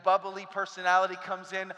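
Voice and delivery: ordinary talk, nothing marked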